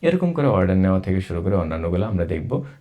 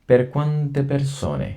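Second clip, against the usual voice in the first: Per quante persone drawn out very slowly and higher; slow drawn-out reading; 6 semitones higher, much slower than usual. Shown as a demonstration. Teacher stretches the question very slowly for learners. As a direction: drag each syllable for the class. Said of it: "এটা আমি দুইবার করে পড়বো".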